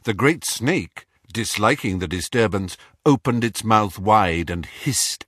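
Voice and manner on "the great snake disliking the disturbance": ordinary delivery, nothing marked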